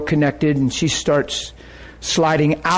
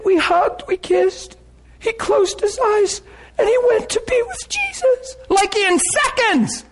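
very high, tearful mock whimper. Wife on the phone reporting husband's death, imitated whimpering. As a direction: imitate a woman whimpering through her news. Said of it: "and she's whimpering".

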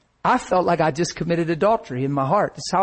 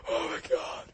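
unvoiced, very soft mock sob; tearful gasp; 13 dB quieter than usual. Husband reenacting his despair before his wife, tearful gasp. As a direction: squeeze it out with no voice. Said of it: "how hard I was crying".

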